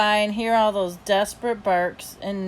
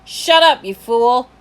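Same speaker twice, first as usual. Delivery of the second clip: loud, high burst of anger; yell; 4 semitones higher, 10 dB louder, slower than usual. A narrator's reading. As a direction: yell it sharply at the dog. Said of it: "and yelled".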